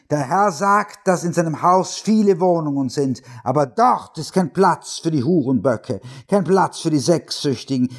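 high, relentless pulpit cadence; fire-and-brimstone preacher condemning sinners; hammering hellfire preacher. A narrator's reading. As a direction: hammer every kein Platz. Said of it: "kraftvollen, hämmernden Kaskade".

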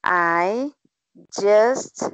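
careful word-by-word reading aloud; slow word by word. Shown as a demonstration. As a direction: read slowly, one word at a time. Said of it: "cobrindo as palavras devagarzinho".

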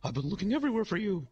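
high-pitched manic clown greeting; intense, slightly unhinged high voice; Ronald McDonald. Retold or imitated. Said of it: "you seem kind of intense right now, a little manic".

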